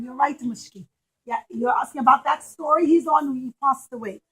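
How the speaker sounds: ordinary delivery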